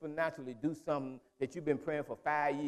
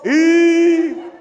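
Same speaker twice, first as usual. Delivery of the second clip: mock shriek; 13 semitones higher, 19 dB louder than usual. Imitating sitcom lady's shriek.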